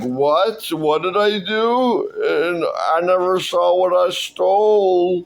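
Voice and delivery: high, drawn-out mock wail; incredulous protest. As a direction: protest in hammed-up disbelief, stretching the words. Thief character protests the accusation.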